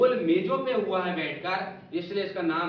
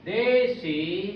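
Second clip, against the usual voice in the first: देशी drawn out slow and louder; stretched emphasis; 2 semitones higher, 6 dB louder, much slower than usual. Shown as a demonstration. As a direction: lean hard on देशी, stretching it. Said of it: "stretching key word".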